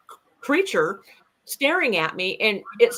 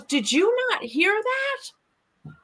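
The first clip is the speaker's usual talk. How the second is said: slow, much higher, disbelieving; incredulous question; 12 semitones higher, much slower than usual. Retold or imitated. Shelly asks him in disbelief, slow and high. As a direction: stretch it out in disbelief. Shelly voiced in retold scene.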